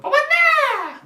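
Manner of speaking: high, drawn-out shout for grandma; bağırarak seslenme. Retold or imitated. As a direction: yell loudly as a child calling grandma. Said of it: "diye bağırdım". (tiz sesle bağırarak çağırma)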